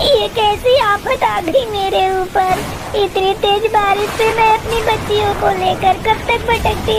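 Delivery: a widowed bird wailing at her bad luck; lamenting mother bird. Mother bird to her daughters in the rain, lamenting.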